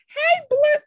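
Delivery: very high, bright sung-out greeting; delighted greeting. Retold or imitated. Greeting Blue with the blue hair, delighted high hello. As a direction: sing out the hello like a compliment.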